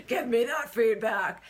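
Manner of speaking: mock outrage broken by laughter; slow, hammed-up indignation with a laugh inside; anger, annoyance. Wife reacting to husband's feedback, mock angry. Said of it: "Anger, annoyance".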